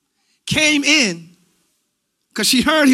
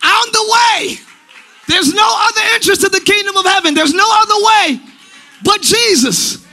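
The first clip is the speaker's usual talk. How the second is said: loud, fast preacher's shout; preaching at full force; 8 semitones higher, 4 dB louder, faster than usual. Acted. Pastor preaching loudly to his congregation.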